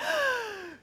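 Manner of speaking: high mock gasp of dread; panicked gasp. Retelling dread at group prayer, panicked gasp. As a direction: gasp as if caught out.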